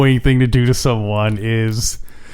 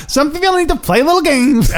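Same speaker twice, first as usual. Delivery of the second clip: high mock voice; 14 semitones higher than usual. Quoting Geary line.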